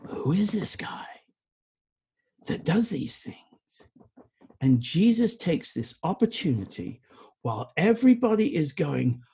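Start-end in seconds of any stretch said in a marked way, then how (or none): 0.1-3.4 the crowd whispering, hushed and amazed; awed whisper. Acted. Crowd murmuring about Jesus after the healing, whispered awe. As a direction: murmur it in wonder.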